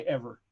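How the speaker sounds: "ever" for every, final Y left off; dropped Y. Shown as a demonstration. Host teaching the drawl, "ever" without its Y. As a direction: end "ever" on the r, no Y. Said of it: "You don't say the Y".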